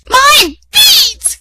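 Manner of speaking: high, loud outraged cry; snatching back her beads; furious shriek. A narrator's reading. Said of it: "cried one stepsister".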